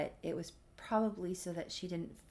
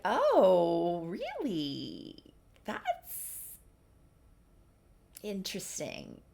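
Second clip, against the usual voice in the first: drawn-out skeptical reaction; 3 dB louder, much slower than usual. Retold or imitated. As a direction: stretch it out as a mock-intrigued reply. Acted reaction in retold dialogue.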